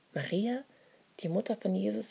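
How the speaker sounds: ordinary delivery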